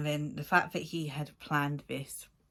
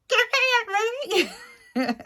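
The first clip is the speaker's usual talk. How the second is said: mock demanding yell; 7 dB louder, slower than usual. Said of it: "And I'm just like".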